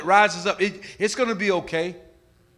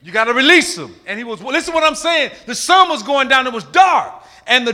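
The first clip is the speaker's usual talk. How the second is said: ordinary talk, nothing marked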